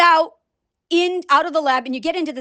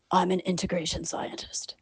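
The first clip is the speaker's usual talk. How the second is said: whisper; 7 semitones lower, 11 dB quieter than usual. Acted. Mock introduction trailing off.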